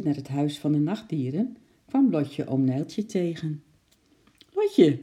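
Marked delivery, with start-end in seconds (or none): 4.5-5.0 high, loud, jolly hail; cheerful call. A narrator's reading. Oom Nijltje hailing Lotje on the lane, cheerful call. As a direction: call out brightly with good news to share. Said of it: "riep hij vrolijk".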